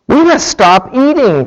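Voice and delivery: crying out. A narrator's reading. Toad urges Frog to stop eating, crying out. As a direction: cry it out in mild alarm. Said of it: "cried Toad as he ate another".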